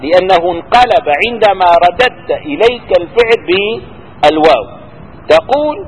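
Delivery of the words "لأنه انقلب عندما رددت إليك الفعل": ordinary talk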